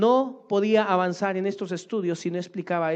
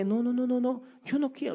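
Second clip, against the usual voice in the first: mock protesting parent; 9 semitones higher than usual. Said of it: "doting parent protesting".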